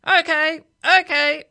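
bossy parrot. A narrator's reading. Parrot takes charge of the bus, loud and bossy. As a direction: call it out like a pushy bird. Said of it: "it said".

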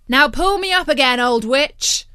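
calling loudly up a tree shaft. Acted. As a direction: shout it up from far below. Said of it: "called up through the tree".

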